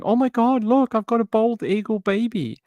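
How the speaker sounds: high, squealing mock delight over a baby eagle; mock giddy, high-pitched cooing; gushing tourist. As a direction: coo like a thrilled tourist showing off a pet. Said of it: "walking around with it going".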